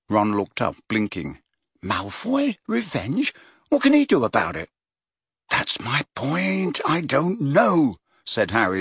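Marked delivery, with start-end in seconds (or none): 1.8-4.7 high, incredulous Ron voice; as if the idea is absurd; baffled disbelief. A narrator's reading. 5.5-8.0 frustrated. A narrator's reading.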